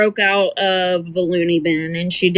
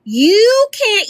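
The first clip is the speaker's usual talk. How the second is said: ordinary talk, nothing marked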